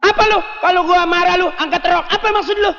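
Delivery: angry husband. Acted. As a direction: snap at the wife like a fed-up husband. Angry husband quarrel, high.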